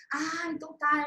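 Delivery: usual speaking voice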